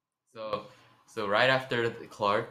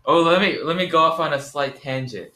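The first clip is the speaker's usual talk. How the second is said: normal talking voice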